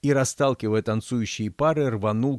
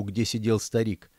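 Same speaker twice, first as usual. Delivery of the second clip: plain reading voice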